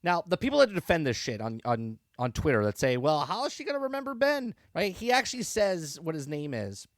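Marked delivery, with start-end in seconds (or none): 3.0-4.5 much higher, mocking imitation of the defenders; mocking whine. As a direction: ridicule the fans by parroting them up high.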